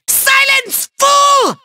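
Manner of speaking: very high, shrieked command; hammed-up villain shriek; angry silencing. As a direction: shut an underling up sharply. Villain silencing his underling.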